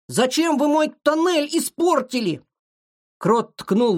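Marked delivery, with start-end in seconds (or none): 0.1-2.4 high-voiced indignant complaint; aggrieved little mole. A narrator's reading. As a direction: reproach them in a high small voice. Mole reproaches the detectives. (обиженный высокий голосок крота)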